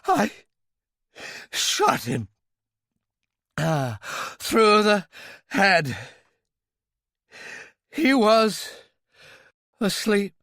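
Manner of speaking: high, loud fearful answering; frightened confession. Acted. Royale answering Talbot, high and loud in fear. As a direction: blurt it out in fear. Terrified confession under threat.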